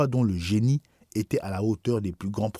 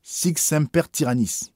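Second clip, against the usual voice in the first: higher, slow, ringing exclamation; cri de l'assassin; 7 semitones higher, 4 dB louder, slower than usual. A narrator's reading. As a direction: proclaim it slowly, raised in pitch like a battle cry. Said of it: "il s'écria". (s'écrier d'une voix haute et lente)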